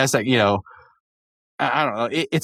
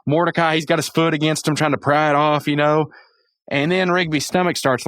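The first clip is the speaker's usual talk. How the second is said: usual speaking voice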